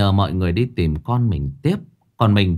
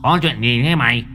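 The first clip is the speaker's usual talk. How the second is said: squinting boss; 3 semitones higher, 3 dB louder than usual. A narrator's reading. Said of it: "Đại ca Sứt nheo mắt hỏi".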